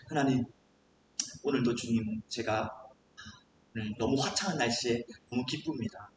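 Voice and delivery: low, soft, intimate thanks; quiet prayer. Acted. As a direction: talk privately to God. Models a casual prayer while commuting.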